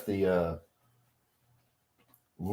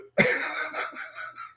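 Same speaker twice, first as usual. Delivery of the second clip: a wheezing, breathy cackle; high-pitched airy laugh; 5 dB quieter than usual. Real, not acted. Laughing after the story.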